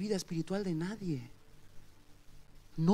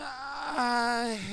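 grito de llanto; 6 semitones higher than usual. Retold or imitated. La madre rompe a llorar.

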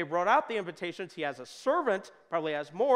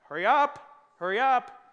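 loud, high mock impatience; a shouted hurry-up at a slow passenger; impatient hurry; 5 semitones higher, 8 dB louder, slower than usual. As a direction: urge on the slow walker with a raised, pitched-up voice. Impatient traveller behind slow person.

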